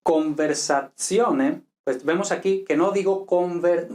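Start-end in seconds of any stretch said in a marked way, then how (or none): 0.0-1.6 conversazione drawn out slowly, syllable by syllable, z as ts; careful Italian. Shown as a demonstration. Teacher introduces the word, slow careful conversazione. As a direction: slowly model conversazione with a t-s in -zione. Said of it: "si tenemos la palabra".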